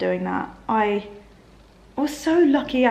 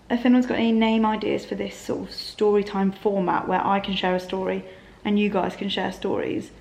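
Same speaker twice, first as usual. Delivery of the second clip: ordinary talk, nothing marked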